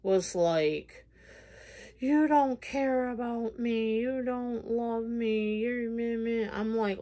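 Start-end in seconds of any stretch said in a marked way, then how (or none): 2.0-6.4 higher, whiny imitation of the ex; mocking her ex. Ex-boyfriend's whining, mimicked for the viewers. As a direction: mimic his clingy complaint, lightly.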